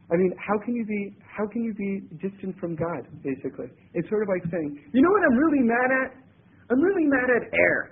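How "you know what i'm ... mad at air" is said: a lightly voiced grumbler, faster and higher; mock gripe about hating air, quick and peeved; grumbling complainer. Preacher voices a man complaining.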